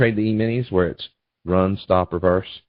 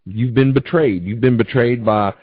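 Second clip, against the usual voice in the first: ordinary talk, nothing marked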